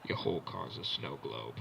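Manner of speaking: very low, softened cool-host voice; Exhibit. Retold or imitated. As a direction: show off the car, deep and unhurried. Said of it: "like, Exhibit comes out".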